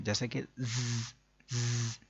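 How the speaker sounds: ज़ as a long buzzing z by itself; sustained z-buzz on ज़; sharp whistling sound. Shown as a demonstration. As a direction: hiss out ज़ like a whistle. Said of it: "सीटी की तरह की तेज़ आवाज़".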